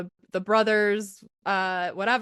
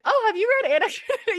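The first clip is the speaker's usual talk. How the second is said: eager gush; 13 semitones higher, 4 dB louder, much faster than usual. Retold or imitated. Retold eager question to boyfriend.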